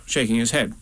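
plain reading voice

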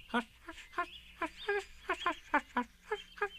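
little voice hushing itself over and over; very quiet repeated hush. A narrator's reading. Roo hushing to himself, very quietly. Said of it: "several times to himself very quietly".

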